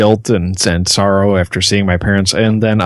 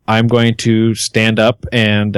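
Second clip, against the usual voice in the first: normal talking voice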